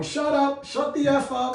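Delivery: very high-pitched bird mimicry; talking bird. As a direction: imitate a bird parroting an insult. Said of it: "and the bird is going".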